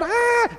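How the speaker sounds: a high mock yelp of Ah; imitated child scream. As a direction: toss out a playful kid's squeal. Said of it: "And about the third".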